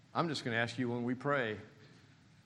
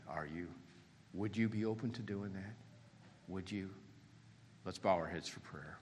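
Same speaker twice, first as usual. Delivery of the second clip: ordinary delivery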